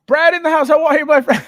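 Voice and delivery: loud, high hype greeting; shouted welcome. Real, not acted. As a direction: shout out the new arrival. Calling out welcome to viewer.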